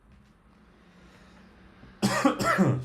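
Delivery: sharp loud coughing fit. Real, not acted. Coughing fit, then excuse me.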